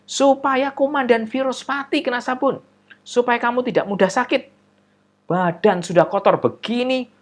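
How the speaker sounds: lecturing mother. Acted.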